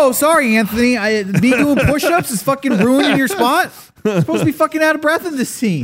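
put-on high, indignant young actor; whiny young actor. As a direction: complain like a fussy young actor. Mocking young actor's complaint.

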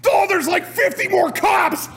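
high-pitched mock alarm yell; frantic game shouting. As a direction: cry out way up high, stretching it out.

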